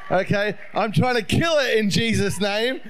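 usual speaking voice

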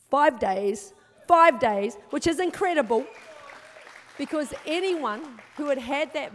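five days shouted out loud; shouting. Real, not acted. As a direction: proclaim it at full voice, triumphant. Testimony climax, shouted.

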